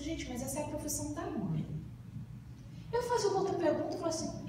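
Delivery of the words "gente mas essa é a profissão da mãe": softly, half to herself; a hushed aside of realisation; quiet surprised aside. Retold or imitated. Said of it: "Eu falei assim". (comentário baixinho, surpreso)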